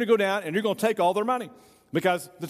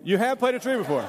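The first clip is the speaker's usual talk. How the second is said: higher, incredulous echo question; amused disbelief; 4 semitones higher than usual. Pretend. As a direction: repeat it back amazed, on the edge of laughter. Amazed echo of the yes answer.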